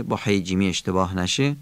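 normal talking voice

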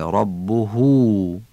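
ربه with a long final "huu"; slow ربه, last vowel held long; drawn-out ending. Shown as a demonstration. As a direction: stretch the end of ربه right out.

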